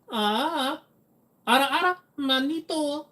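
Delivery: put-on high anime girl voice; squeaky high "Ara, ara" tease. Anime style take on word.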